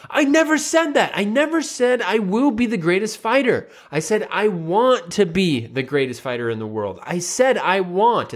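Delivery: put-on high, whiny kid protest; indignant high-pitched denial; sensitive denial. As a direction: imitate an upset boy insisting he's been misquoted. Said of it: "the older brother got very sensitive".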